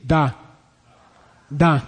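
short 'Da' with a plain English D. Shown as a demonstration. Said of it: "there's a real English D".

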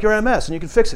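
sibling pushing a cure on brother, high and loud; mock eager pitch. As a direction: blurt out the fix. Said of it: "it's like".

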